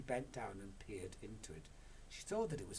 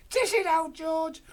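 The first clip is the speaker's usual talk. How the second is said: very high, loud call; Mister Cranky calls to George, high and loud; eager cry; 19 dB louder than usual. Acted. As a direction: urge George on. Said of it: "cried Mister Cranky".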